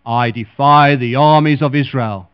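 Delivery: loud, booming giant's defiance; Goliath's taunt. Acted. Preacher voicing Goliath taunting Israel's army, loud booming. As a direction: boom it across the valley like a giant.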